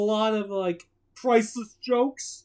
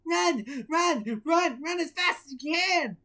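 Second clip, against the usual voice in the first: taunting; 7 semitones higher than usual. A narrator's reading. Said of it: "called back".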